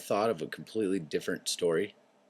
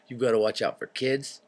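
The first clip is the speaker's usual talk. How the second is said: ordinary delivery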